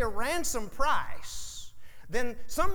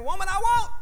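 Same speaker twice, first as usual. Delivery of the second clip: higher, louder "Woman, I won't"; mock defiant; 9 semitones higher, 5 dB louder, faster than usual. Preacher joking he says it to his wife, mock defiant. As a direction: belt out the refusal jokingly. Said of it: "I've been saying".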